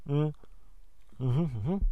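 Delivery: throat-clearing hums after choking; choked throat. Real, not acted. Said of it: "Ich hab mich grad verschluckt".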